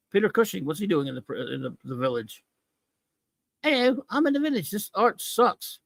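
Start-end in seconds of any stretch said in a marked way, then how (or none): 3.6-5.8 mocking character voice. Reviewer mocking Peter Cushing's comic character in a much higher silly voice. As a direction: voice the character as a dim-witted simpleton.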